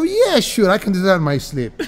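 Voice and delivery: high-pitched mock bragging, tossed off fast; cocky boast. Young punk telling magician he knows the trick, cocky. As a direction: imitate a cocky young know-it-all.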